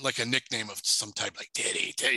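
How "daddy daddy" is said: a breathy mock moan, barely imitated; ghostly moaning. Demonic voice on the phone moaning the husband's nickname, breathy imitated moan. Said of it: "moaning his name".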